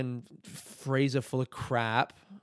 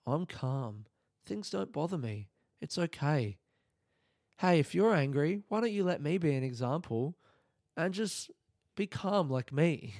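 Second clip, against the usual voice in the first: soothing and over-gentle, parodying someone very calm; mock calm voice; 3 semitones higher than usual. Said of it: "had that fucking calm voice".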